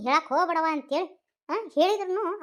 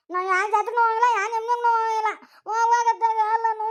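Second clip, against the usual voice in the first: whiny refusal; 6 semitones higher, 3 dB louder than usual. Acted. Daughter sulks, refuses the fair.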